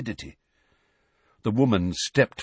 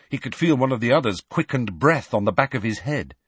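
plain reading voice

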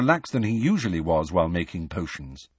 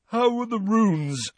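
slow, higher, drowsy voice; Ron yawning; 12 semitones higher, slower than usual. A narrator's reading. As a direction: yawn through the question.

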